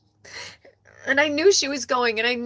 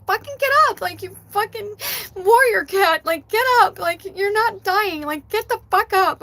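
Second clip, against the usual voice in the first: loud, higher, forceful urging; retold tough love; 6 semitones higher, 4 dB louder than usual. Owner urging her dying warrior cat, forceful tough love. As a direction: drill it in as exasperated commands. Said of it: "my third dimensional tough love was like".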